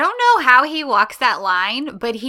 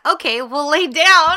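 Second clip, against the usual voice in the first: higher, louder, slow mock concession; cheeky concession; 6 semitones higher, 5 dB louder, slower than usual. He counters her refusal with a new offer, cheeky. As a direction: imitate him offering it playfully, drawn out.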